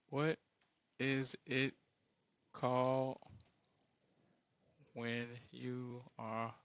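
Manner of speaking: slow, halting question, trailing soft; slow, searching question. Real, not acted. Asking viewers slowly for the missing word. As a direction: feel for each word, pausing between them. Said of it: "Question mark".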